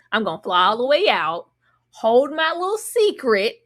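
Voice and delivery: ordinary talk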